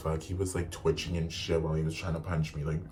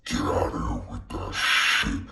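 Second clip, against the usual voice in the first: put-on very low, slow old-man voice; mock angry old man; 11 semitones lower, 4 dB louder than usual. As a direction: imitate an old man ordering someone out. Retelling the old man's angry words.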